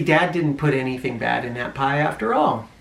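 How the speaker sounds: plain reading voice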